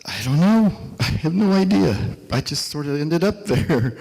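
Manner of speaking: high, loud, overwhelmed answer; retold bewilderment. Architect answering the crying taxi driver, bewildered and overwhelmed.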